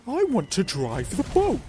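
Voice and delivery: high, loud, drawn-out demand; insistent character voice. Acted. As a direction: plead slowly in a high put-on voice. Acts G demanding the boat.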